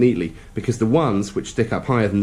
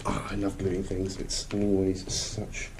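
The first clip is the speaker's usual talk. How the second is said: sarcastic; 11 dB quieter, slower than usual. Real, not acted. Lid stuck, ironic complaint.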